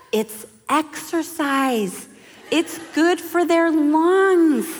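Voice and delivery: put-on slow, high-pitched Bostonian accent; Judy. Mentor explains crying to new mother, drawn-out Bostonian accent. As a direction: imitate a Bostonian mentor, words stretched out. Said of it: "she's got a little Bostonian accent".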